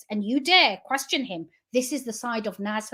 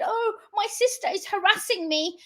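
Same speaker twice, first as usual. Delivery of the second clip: higher-pitched mock whimper; retold weepy plea in a higher pitch; mock crying; 7 semitones higher than usual. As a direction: imitate a tearful complaint. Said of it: "cried and said".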